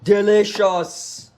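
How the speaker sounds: slow, loud "Delicious"; savoring. Acted. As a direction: draw it out like a tasting chef. Chef tasting, slow and drawn out.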